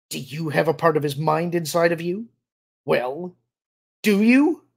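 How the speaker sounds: scornful questions rising to a loud, high demand; taunt, then demand an answer sharply; sneering challenge. A narrator's reading. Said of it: "Tyr sneered".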